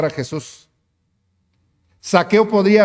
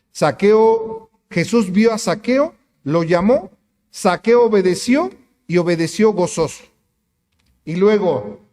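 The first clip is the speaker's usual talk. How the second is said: ordinary talk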